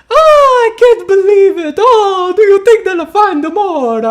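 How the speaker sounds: loud, very high wail in a bad Italian accent; hammed-up Italian accent, wailing in despair at the top of the voice; mock-crying Italian shriek; Jared Leto. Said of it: "Jared Leto's Italian accent in House of Gucci".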